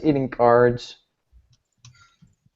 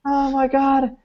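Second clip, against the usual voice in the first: high-pitched comic wail; mock panicked cry; 11 semitones higher, slower than usual. Off-screen bystander as windows break, mock panicked cry. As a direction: cry it high in hammed-up horror.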